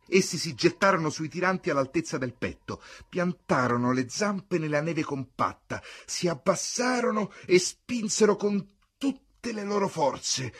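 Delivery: high, loud, urgent storytelling; straining excited narration. A narrator's reading. Narrator on dogs heaving at the sled, urgent loud narration.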